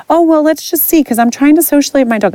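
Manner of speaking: high, fast put-on voice, pitch way up; breezy clueless dog owner, hammed-up; airy singsong imitation of a pushy owner; mock oblivious owner.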